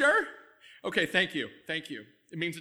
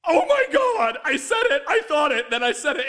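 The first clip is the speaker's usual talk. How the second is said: horrified shout; 8 semitones higher, 9 dB louder than usual. Acted. Shouts in horror at slip.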